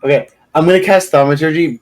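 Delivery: ordinary talk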